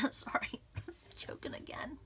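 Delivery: laughing whisper, voice gone; real whisper with a laugh; whispered aside. Real, not acted. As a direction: whisper it through a tickly throat. Throat trouble, laughing it off.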